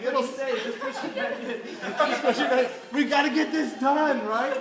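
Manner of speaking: hammed-up bossy big brother cracking up; laughing urging. Telling his bleeding brother to push it back, laughing loudly.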